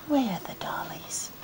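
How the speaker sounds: hushed whisper, almost silent; whispering over a crib. Real, not acted. Whisper after "go to sleep.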